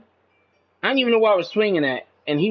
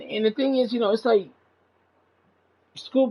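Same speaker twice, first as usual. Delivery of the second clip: ordinary talk, nothing marked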